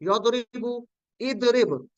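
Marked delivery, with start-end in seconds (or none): none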